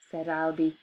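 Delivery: plain reading voice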